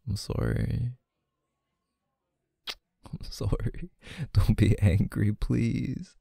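soft pleading apology. Acted. Pleading with upset girlfriend.

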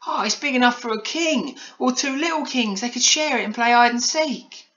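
put-on very high-pitched girlish wonder; excited marvelling. A narrator's reading. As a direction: gush like an amazed child. Polly marvels at the mansion.

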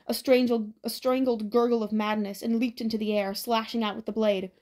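ordinary delivery, nothing marked

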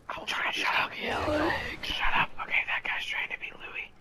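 whispering about the impostor. Real, not acted. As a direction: whisper the complaint. Whispering throughout.

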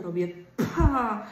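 "pa" said slowly and loud, stretched out; slow blown syllable; syllable demo. Shown as a demonstration. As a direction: puff out "pa" slowly as if blowing pompoms. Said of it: "kładziemy na przykład pompony".

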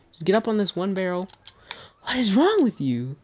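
usual speaking voice

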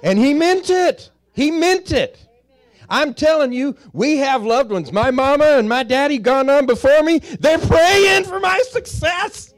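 high, loud climactic preaching; a preacher's rising cry about praying parents; preaching at full force. Real, not acted. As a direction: raise it up and shout it to the congregation.

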